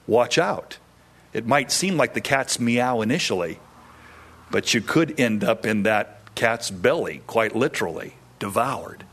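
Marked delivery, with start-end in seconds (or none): none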